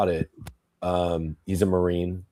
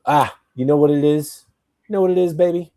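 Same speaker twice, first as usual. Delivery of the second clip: put-on voice; 8 semitones higher, 5 dB louder than usual. Sudden put-on voice, teasing.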